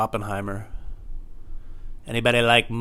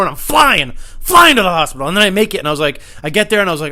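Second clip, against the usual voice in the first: ordinary talk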